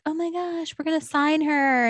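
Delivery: high-pitched, lightly acted amazement; mock excitement. Someone in the record store hears her singing, imitated excitement. Retold talent scout discovering a singer.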